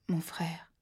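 un murmure sans voix, presque un souffle; murmuré. A narrator's reading. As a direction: souffle les mots à peine, épuisé. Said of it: "murmura l'homme". (chuchoté par un homme blessé)

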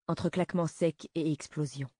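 ordinary delivery, nothing marked

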